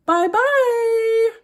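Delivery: very high, loud squeaky bye-bye; the wife vanishes with a very high, loud bye-bye; mock farewell. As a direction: squeak a goodbye as she vanishes. Wife vanishes, teacher mocks.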